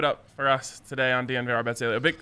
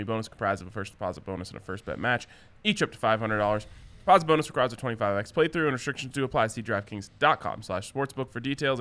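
fast legal disclaimer. Acted. Rapid legal fine print.

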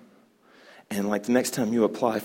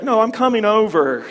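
pushy blinker; 11 semitones higher, 10 dB louder than usual. Pretend. Pushy New York blinker.

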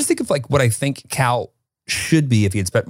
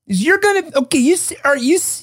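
put-on much higher, singsong Minnesotan accent, overly friendly; 3 dB louder than usual. Minnesotan local welcoming a lost tourist, high singsong accent. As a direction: gush like a Minnesotan eager to help. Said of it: "That was pretty good".

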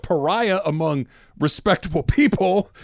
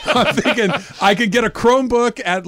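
words bubbling with laughter; laughing while talking; 6 semitones higher, 4 dB louder than usual. Real, not acted. Joking about replacing the laptop, laughing. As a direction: laugh through the line. Laughing through his plan.

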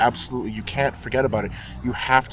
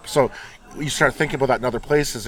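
normal talking voice

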